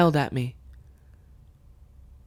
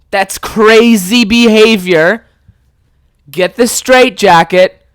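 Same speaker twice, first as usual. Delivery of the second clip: loud, incredulous outburst at dad, stretched stressed words; exasperated rant; 3 semitones higher, 7 dB louder than usual. Acted. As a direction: shout it at dad, utterly fed up. Acted outburst at dad over shed.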